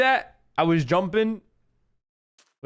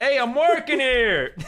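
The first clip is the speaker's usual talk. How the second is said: slow, higher New York "Hey, I'm working here"; a mock New York cabbie gripe, drawn out; New York accent; 10 semitones higher, 3 dB louder, slower than usual. Shown as a demonstration. Streamer to friend, a mock New York gripe about being interrupted. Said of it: "the New York came out of me".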